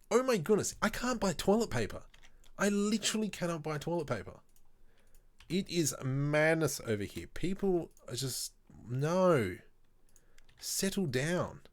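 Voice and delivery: high-pitched, worked-up exasperation; exasperated venting. Real, not acted. Streamer venting to viewers about toilet paper panic buying, exasperated. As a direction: rant in disbelief at the madness.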